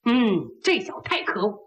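usual speaking voice

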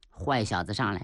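usual speaking voice

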